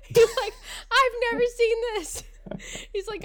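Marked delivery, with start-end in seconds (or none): 0.9-2.2 loud, high imitation of astonishment; acted shock, pitched way up; stunned disbelief. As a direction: blurt it out like an aghast doctor. Chiropractor's shocked reaction, retold.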